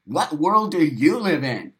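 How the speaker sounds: ordinary talk, nothing marked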